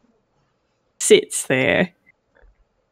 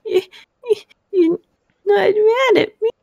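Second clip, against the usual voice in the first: tearful, very high tiny voice; small frightened child, stammering; sniffling little girl; 11 semitones higher than usual. Acted. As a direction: squeak it out haltingly through tears. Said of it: "she's sniffling and rubbing at her".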